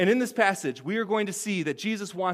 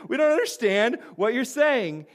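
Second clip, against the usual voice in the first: lightly voiced baffled disciples, pitched higher; confused disciples; 4 semitones higher than usual. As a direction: imitate bewildered followers, voice rising. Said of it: "the disciples, if you remember, they're like".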